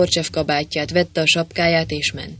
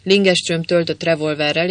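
ordinary delivery

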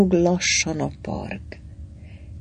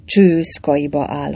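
plain reading voice

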